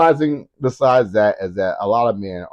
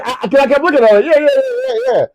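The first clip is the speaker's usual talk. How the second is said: laughing; 13 semitones higher, 4 dB louder, much faster than usual. Real, not acted. Laughing while telling.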